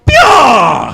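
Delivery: high, loud "Pyaw" blast; comic laser pyaw. Shown as a demonstration. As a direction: fire off a cartoon zap. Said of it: "You wanna know what that sound is?".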